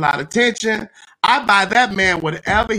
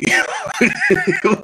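high burst of laughter; laughing. Real, not acted. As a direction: crack up laughing. Breaks up mid demo.

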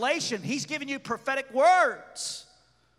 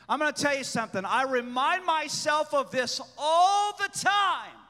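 usual speaking voice

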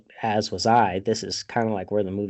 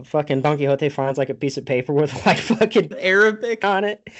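ordinary talk, nothing marked

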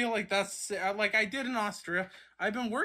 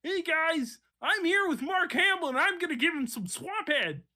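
Kermit impression; 8 semitones higher than usual. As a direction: squeak it out like Kermit. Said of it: "Oh, Kermit".

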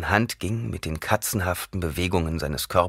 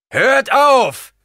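much higher, louder bellow; 10 dB louder than usual. A narrator's reading. Gurney bellows at his fighting men to stop. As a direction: roar across the battlefield to stop. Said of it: "brüllte Halleck".

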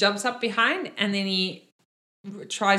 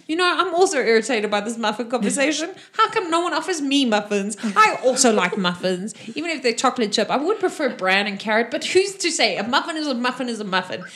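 raised, louder aggrieved horse voice; talking horse, irritated; 4 semitones higher, 5 dB louder, faster than usual. Acted. GM voices horse complaining nobody offers it muffins, indignant. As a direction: rant like a put-out horse. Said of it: "the horse is like".

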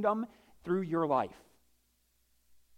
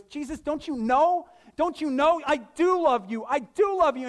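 higher, louder pleading protest; frustrated Peter; 8 semitones higher, 5 dB louder than usual. Retold or imitated. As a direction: insist he loves him, exasperated. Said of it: "I'm frustrated".

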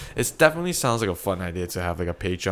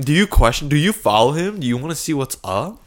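loud, high, incredulous storytelling; excited; 6 semitones higher, 7 dB louder than usual. Acted. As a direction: build the absurd scene. Animated hypothetical story.

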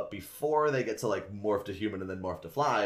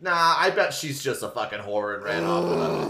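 mock bully sneer; 8 semitones higher, 6 dB louder than usual. Bully insult, voiced up.

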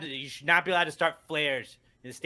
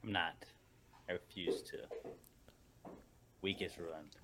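low, quiet, dejected sulk; glum, deflated murmur; sulking refusal; 8 semitones lower, 16 dB quieter than usual. Real, not acted. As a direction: refuse softly, determined to stay miserable. Refuses to cheer up.

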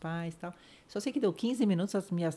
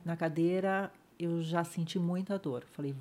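ordinary delivery

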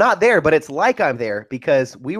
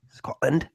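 long pause before Scotland, then Scotland said with a rise; dramatic pause; 4 semitones higher, 5 dB quieter than usual. Shown as a demonstration. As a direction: hold the beat, then land Scotland. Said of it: "You like that pause before I said that?".

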